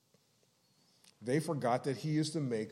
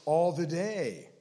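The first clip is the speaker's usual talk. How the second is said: all the day slowed, higher and louder; stress on all the day; 4 semitones higher, 6 dB louder, slower than usual. Shown as a demonstration. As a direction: lean hard into all the day. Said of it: "but not just continually".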